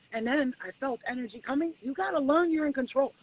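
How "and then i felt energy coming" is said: ordinary talk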